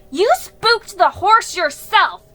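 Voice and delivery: high, very loud accusation; defiant shout. A narrator's reading. Felicity blaming Jiggy right back, defiant shout. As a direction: call out indignantly at full voice. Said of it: "Felicity called out".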